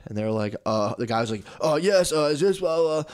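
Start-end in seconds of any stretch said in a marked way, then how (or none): 1.6-3.0 higher, louder flustered collector; mock collector. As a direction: stammer like a flustered caller.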